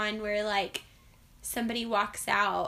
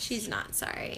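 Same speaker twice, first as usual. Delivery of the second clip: whispered teasing aside; whispering; 4 semitones lower, 9 dB quieter than usual. Real, not acted. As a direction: whisper it behind her back. Teasing aside while co-host apologizes.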